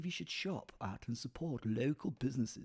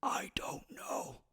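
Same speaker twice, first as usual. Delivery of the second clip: silent, slow whisper; mouthed; much slower than usual. Retold or imitated. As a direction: mouth it back without voice. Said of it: "whilst mouthing".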